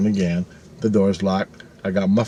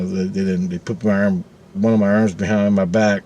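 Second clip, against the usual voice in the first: usual speaking voice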